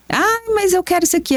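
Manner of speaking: voz aguda de criança pidona; criança pedindo. Retold or imitated. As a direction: imita o filho implorando no mercado. Filho pedindo no mercado. (filho pedindo tudo no mercado com voz aguda)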